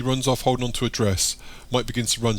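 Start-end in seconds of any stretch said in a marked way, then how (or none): none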